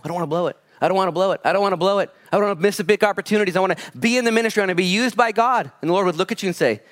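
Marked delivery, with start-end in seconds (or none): 0.0-5.7 repeated plea climbing higher and faster; fretful self-talk that builds and speeds up; anxious pleading. Retold or imitated. Inner voice of striving believer.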